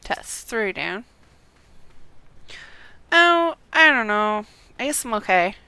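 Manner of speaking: ordinary talk, nothing marked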